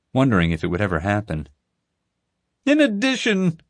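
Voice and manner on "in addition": proclaiming protester. A narrator's reading. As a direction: proclaim it like a fervent protest leader. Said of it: "Crazy Panda Guy was saying".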